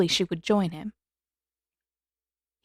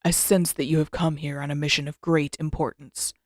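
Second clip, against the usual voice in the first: put-on lower, measured voice of an old master; a grumpy elder rebuking his student, lower; stern old Avatar Roku; 5 semitones lower than usual. Roku chides Aang for dawdling, in a lower stern voice. Roku's voice in story.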